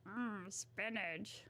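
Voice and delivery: slow cartoon sailor mumble; drawn-out grunt, then the word; Popeye. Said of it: "Popeye the Sailor Man. I just know he goes like".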